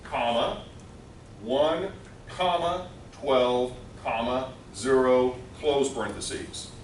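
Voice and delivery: slow letter-by-letter read-out; dictating slowly. Shown as a demonstration. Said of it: "let me read it out".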